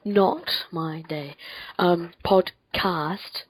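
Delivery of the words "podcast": PODCAST very slowly, split into "pod" and "cast"; careful pronunciation. Real, not acted. Podcaster getting "podcast" right after stumbling, slow and careful. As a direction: draw out "podcast" syllable by syllable. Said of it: "I've got it now".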